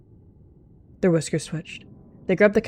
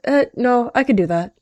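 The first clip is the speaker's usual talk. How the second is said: louder, higher interjection; cutting in; 7 semitones higher, 7 dB louder than usual. A narrator's reading. Cat butting into the plan, cutting in loudly. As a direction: jump in over the other speaker, loudly. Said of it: "she cut in".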